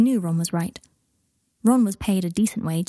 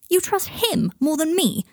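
high, drawn-out indignant question; outraged; 9 semitones higher than usual. A narrator's reading. Ron to Harry, who trusts Malfoy, outraged. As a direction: draw it out in high-pitched outrage. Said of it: "Ron made an outraged sound".

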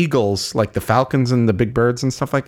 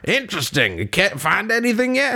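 nosy cowboy, rising higher; Arthur Morgan; 8 semitones higher than usual. Acted. Arthur quizzing the gold panner, cowboy curiosity. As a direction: probe with put-on cowboy curiosity. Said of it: "And he's like".

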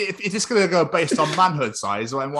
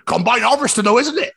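higher Bristol yokel interjection; mock Bristol accent; 8 semitones higher, 5 dB louder than usual. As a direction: chime in as a cartoon Bristolian. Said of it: "when they wanna do a Bristol or Southern accent".

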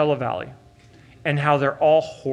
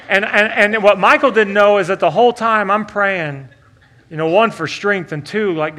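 ordinary talk

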